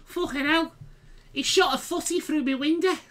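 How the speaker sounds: high, badly faked Scouse; fake Scouse accent. Moaning about a footy through the window, mock Scouse. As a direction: squeak out a caricature Scouse. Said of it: "I can't fucking do the Scouse accent".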